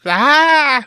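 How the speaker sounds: high bleating goat scream; yelling goat. Retold or imitated. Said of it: "those yelling goats".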